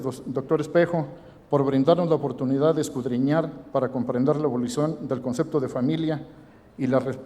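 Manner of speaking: usual speaking voice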